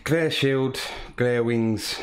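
ordinary talk

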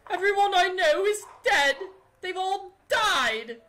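high-pitched hammed-up wail of grief; crazed Missus Drake. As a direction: wail it like a lonely old woman gone crazy. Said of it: "Missus Drake went crazy over the years".

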